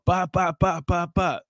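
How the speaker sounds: high, even mock door knocks; imitated knocking. Cop knocking on a restaurant door, imitated knocking.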